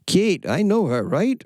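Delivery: a relaxed, playful Kiwi accent imitation. Astrologer correcting herself with the New Zealand version, Kiwi accent. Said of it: "that's more Kiwi stuff".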